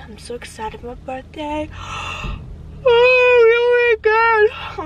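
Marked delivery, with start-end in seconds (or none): 2.9-4.8 slow, loud, high yawn through the words. Real, not acted. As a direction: let the yawn take over. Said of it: "I'm sorry. I'm just so tired".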